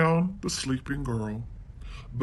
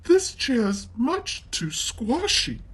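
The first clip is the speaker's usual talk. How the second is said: put-on high, slow girl's voice; fussy little girl; 9 semitones higher than usual. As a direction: complain like a picky young girl. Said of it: "she said".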